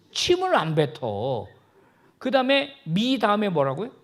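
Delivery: ordinary talk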